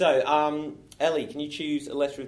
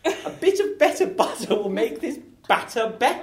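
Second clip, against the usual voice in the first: high, loud, laughing character; 8 semitones higher, 5 dB louder than usual. Acted. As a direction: rattle it off in a high voice. Improv scene, tongue twister in character.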